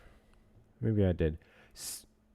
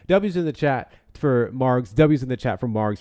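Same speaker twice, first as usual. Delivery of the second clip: hype shout-out; 2 semitones higher, 8 dB louder than usual. Real, not acted. Shout-out repeated.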